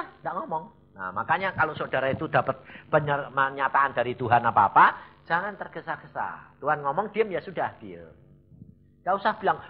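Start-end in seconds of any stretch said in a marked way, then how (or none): none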